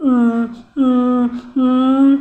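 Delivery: mock whimpering. Pretend crying for child.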